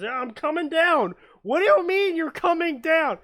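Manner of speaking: high, loud yelling back and forth; excited shout. Retold or imitated. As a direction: shout it while falling. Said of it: "they're like".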